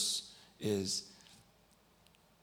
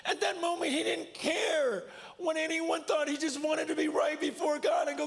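high, tearful, impassioned telling; emotional outcry; 4 dB louder than usual. Real, not acted. Preacher telling camp of boy's confession, overcome. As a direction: cry it out with the voice strained way up.

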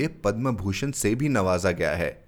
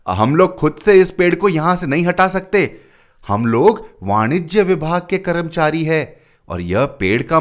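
louder, higher bureaucratic announcement; officious superintendent; 6 semitones higher, 6 dB louder than usual. A narrator's reading. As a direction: lay down the rules officiously. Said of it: "बोला".

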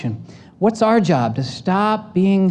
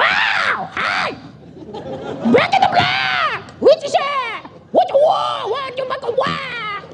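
screeching, very high rock singer impression; slower than usual. Preacher demonstrates the AC/DC singer's voice, high screech. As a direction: shriek it like a hard-rock frontman. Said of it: "do you know the voice?".

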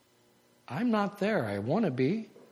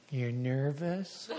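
mock concerned question; 3 semitones lower, 11 dB quieter, slower than usual. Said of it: "People go like this".

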